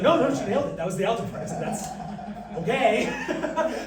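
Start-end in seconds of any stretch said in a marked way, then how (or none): 0.0-1.4 higher, louder, insisting; insistent teacher. Retold or imitated. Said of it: "Teacher's like". 1.6-3.8 drawn out through laughter; laughing. Retold or imitated. Laughing quote.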